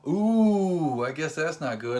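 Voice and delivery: high ooh, then a comic wince; the comedian's pained ooh; mock wince. Comedian reacting to the couple, a high ooh. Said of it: "he goes".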